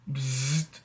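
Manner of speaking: soft buzzing razor noise through the lips; imitated razor buzz. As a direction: mimic a quiet electric razor. Pretending razor turns on.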